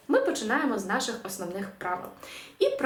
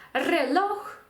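«Релох» modelled as re-LOKH, pitched up; final-syllable stress; 6 semitones higher than usual. Shown as a demonstration. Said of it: "останній склад".

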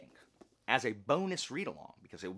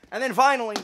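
sudden loud high burst; excited announcement; 13 semitones higher, 15 dB louder, faster than usual. Real, not acted. Booktuber hyping the final pick, loud and high. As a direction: throw it out big like a reveal.

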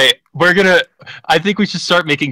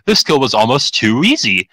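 ordinary talk, nothing marked

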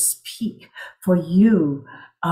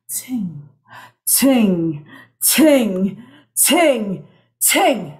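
crescendo; much slower than usual. Shown as a demonstration. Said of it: "pianissimo to fortissimo".

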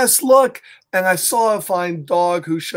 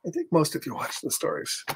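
usual speaking voice

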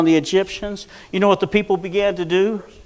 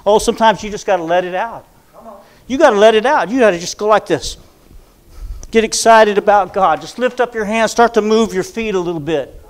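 loud rising preacher's exhortation; preaching at full force; 6 semitones higher, 9 dB louder than usual. Real, not acted. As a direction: whip up the congregation, calling out.

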